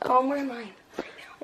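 soft unvoiced whisper. Real, not acted. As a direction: whisper it to the camera. Whispered outfit remarks.

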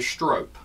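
"strope" with a long O, rhyming with rope; alternate pronunciation. Shown as a demonstration. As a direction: say "strope" with the vowel of "hope". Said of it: "some people pronounce it strope".

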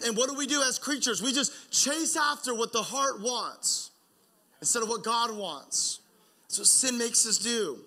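loud, high, driving sermon delivery; impassioned preaching. Real, not acted. Preacher warning congregation against chasing sin, loud impassioned preaching. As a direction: build in pitch and force, urging the congregation.

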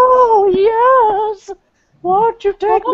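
very high, wailing manager impression; Paul Bearer. Manager calling out, high theatrical wail. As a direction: wail it loud in a piercingly high voice. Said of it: "How's my Paul Bear impression".

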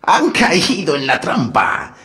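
high, slow gloating witch; wicked witch. A narrator's reading. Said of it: "exclamó la bruja".

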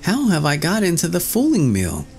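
puzzled, asking the air; bewildered Tom Thumb. A narrator's reading. Tom Thumb lost inside the cow, high bewildered question.

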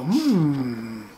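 low skeptical hum through closed lips; a puzzled, doubting hmm; doubtful hmm. Retold or imitated. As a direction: hum like you're unconvinced. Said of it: "make me go".

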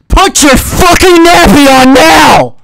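slow, high, booming yell; furious parent yell. Acted. Parent screaming at the baby about its nappy. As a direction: bellow the order like a fed-up parent.

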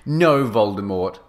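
usual speaking voice

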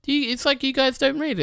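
high, fast, innocent feigned confusion; high mock-innocent corporate voice; mocking YouTube. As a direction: play dumb like a company faking innocence, high and quick. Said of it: "can just come in and be like".

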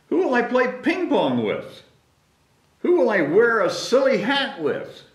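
high, whiny pleading friend; pleading Gerald. Acted. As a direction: beg like a heartbroken big friend.

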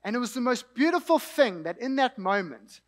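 usual speaking voice

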